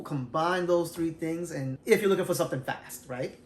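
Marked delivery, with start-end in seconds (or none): none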